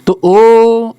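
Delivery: ओ held long and loud in a chant; drawn-out om. Shown as a demonstration. Teacher chanting ओ to show a pluta vowel. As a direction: stretch ओ as in chanting ओम. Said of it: "जैसे ओम".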